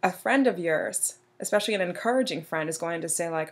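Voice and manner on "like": ordinary talk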